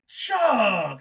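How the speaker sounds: high, loud delighted shout of a name; excited call. Retold or imitated. Charles spotting Chuck at the radio station, delighted shout. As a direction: call out to an old friend you suddenly spot. Recognizing old friend, calling out.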